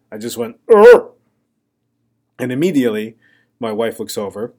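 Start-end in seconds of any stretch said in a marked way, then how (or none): 0.7-1.1 sudden sleepy grunt, loud and high; a loud sleep grunt. Retold or imitated. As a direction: blurt out a startled grunt in your sleep. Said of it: "So what I did was I grunted".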